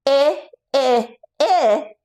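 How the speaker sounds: I as a short i vowel, like the start of itch; short i sound. Shown as a demonstration. Coach modeling the short i sound for kids. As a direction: repeat I, I, I as a clipped short i. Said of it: "give me an".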